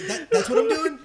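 high, loud disbelieving question; mock incredulous. Actor reading the script, loud and high. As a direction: squeal it in disbelief.

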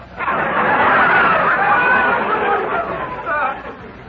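big laugh, rising then falling; hearty laugh. Acted. Luigi laughing with his mother on the phone. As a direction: burst out laughing at the joke.

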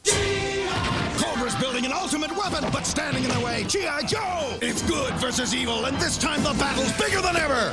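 big booming trailer voice; ad announcer. Acted. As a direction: sell it like an action commercial. Toy commercial announcer read.

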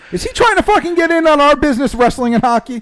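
high-pitched, fast comic protest; mock outrage. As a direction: blow up in joking indignation. Joking outrage over hockey mix-up.

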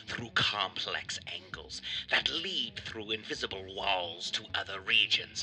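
slow, deliberate announcer read; show intro read. Acted. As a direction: read it like a measured horror-show trailer. Reads the show's intro.

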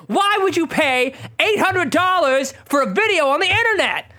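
high, loud Malkovich rant; John Malkovich yelling. Retold or imitated. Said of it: "John Malkovich yelling at you".

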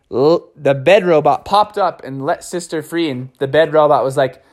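usual speaking voice

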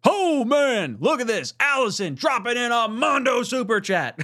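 loud, high-pitched hype announcement; excited shouting. Real, not acted. Host hyping a viewer's huge super chat, excited shouting.

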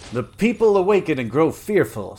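lightly theatrical sardonic villain. A narrator's reading. Villain remarking on the panicked city, sardonically. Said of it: "said Constantius sardonically".

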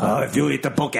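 put-on Japanese gangster accent, up high; broad Japanese crime boss voice. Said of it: "You sound like a Japanese drug lord".

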